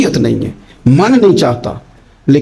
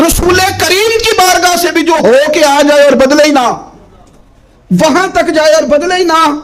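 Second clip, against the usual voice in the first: high, forceful preaching; impassioned preaching; 11 semitones higher than usual. Acted. Preacher scolding congregation for repentance without change, impassioned preaching. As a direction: press the listeners hard with rising fervour.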